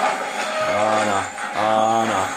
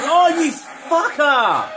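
high, slow, drawn-out furious yell; angry shout; much slower than usual. Real, not acted. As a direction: stretch out the outrage. Caught again by monster.

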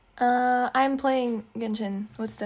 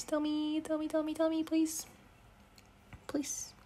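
soft, much quieter begging; soft pleading; 5 semitones higher, 10 dB quieter than usual. Acted. Coaxing her friend to talk, softly pleading. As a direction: coax gently, almost a whisper.

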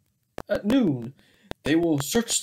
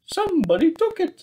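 high, fast alarmed cry; high, quick startled voice; Doctor Bear; 11 semitones higher, faster than usual. Acted. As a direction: blurt it out in a high panic. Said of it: "said Doctor Bear".

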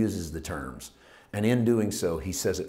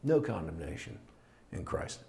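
hushed repeat; 2 semitones lower, 6 dB quieter than usual. Shown as a demonstration. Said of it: "Like, in light of all of this".

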